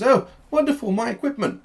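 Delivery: high, loud cry of delight; delighted Doctor. Acted. Doctor reunited with his lost equipment, delighted. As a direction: exclaim it in an excited, high-pitched character voice.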